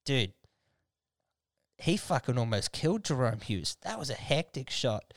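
usual speaking voice

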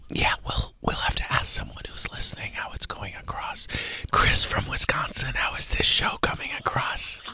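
hushed radio host whisper; breathy secretive whisper on air. Acted. Host whispers all show.

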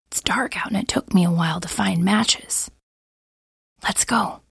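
soft, tense whisper. Acted. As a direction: keep it hushed and nervous. Hushed talk in the dark.

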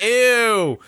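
high, loud imitated squeal of disgust; mock disgust. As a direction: exaggerate someone else's revulsion, high and loud. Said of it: "I thought she was like".

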